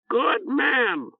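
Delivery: high squawking bird; croaking bird voice. Acted. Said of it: "Oreb informed them".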